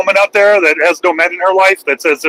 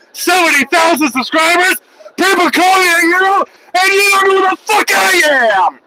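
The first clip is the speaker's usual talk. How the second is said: angry yell; 10 semitones higher than usual. Real, not acted. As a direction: yell it in his face. Yelling at troll about his fame.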